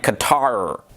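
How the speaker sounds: Qatar said as kuh-TAR, drawn out; variant Qatar pronunciation. Shown as a demonstration. Listing common ways to say Qatar, pronounced a slow kuh-TAR. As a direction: reduce Qatar's first vowel, lengthen the "tar". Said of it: "common ways of pronouncing it are".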